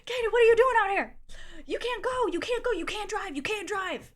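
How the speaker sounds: high, loud, fast frantic calling; imitating the shirtless guy chasing her; mock frantic shout. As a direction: plead that she can't drive.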